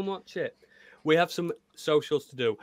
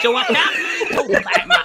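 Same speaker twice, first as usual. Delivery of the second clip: laughing put-on voice; 7 dB louder, slower than usual. Real, not acted.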